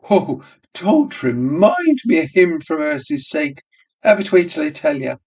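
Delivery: high pleading barmaid. Acted. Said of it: "Miss Douce entreated".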